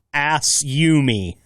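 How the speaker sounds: "Ass you me" split into three slow separate words; sounding out words. Shown as a demonstration. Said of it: "sound it out slowly".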